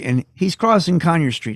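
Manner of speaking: plain reading voice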